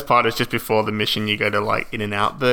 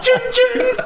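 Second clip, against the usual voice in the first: trophy chime; 4 dB louder than usual. Retold or imitated. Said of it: "it's like".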